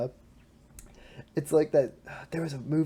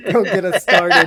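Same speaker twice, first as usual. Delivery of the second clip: don't get us started, shouted loud; loud laughing shout; 5 semitones higher, 12 dB louder than usual. Real, not acted. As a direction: bellow it through laughter.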